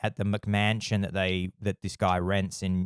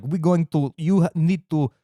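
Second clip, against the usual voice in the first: put-on pushy Russian accent, pitched up and insistent; Russian gangster; 5 semitones higher, 4 dB louder than usual. Gangsters demand a signature on the annulment, insistent Russian accent. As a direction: imitate a Russian heavy making demands. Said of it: "gangsters that represent him".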